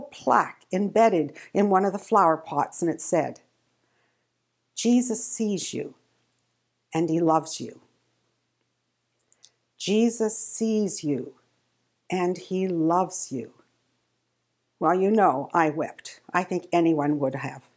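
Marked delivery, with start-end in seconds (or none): 4.7-7.8 reading the plaque. Acted. 9.8-13.5 tender repeat. Acted. Plaque repeated, slower, softer.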